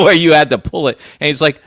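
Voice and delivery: ordinary talk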